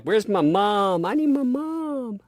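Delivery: put-on very high, slow toddler voice; imitating a toddler. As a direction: whine it like a little girl wanting mom. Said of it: "She's like".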